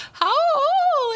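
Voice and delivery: very high, cracking wolf howl; squealed adolescent "owooo"; pubescent howl. Retold or imitated. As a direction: imitate a teenager's failed howl. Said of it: "kids going through puberty, they would howl".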